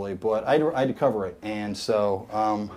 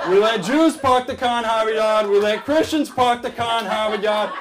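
mock Boston bro; 2 semitones higher than usual. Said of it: "There can only be more Boston".

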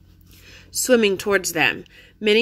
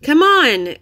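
high, loud, pleading; urgent plea; 7 semitones higher, 7 dB louder than usual. A narrator's reading. Jack urges Annie out of the mud, loud and high. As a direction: yell it to push her on.

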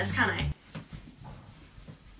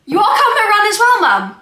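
shouting to another room; calling out; 9 semitones higher, 10 dB louder than usual. Real, not acted. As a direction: call out loud and bright. Calling out to mum.

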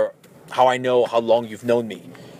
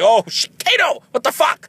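angry outburst; 10 semitones higher, 4 dB louder than usual. Retold or imitated. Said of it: "oh, now I'm pissed".

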